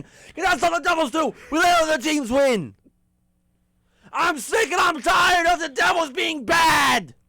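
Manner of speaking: high, loud fed-up yell; angry shouting. Real, not acted. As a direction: shout it out in frustration. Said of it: "time for me to complain".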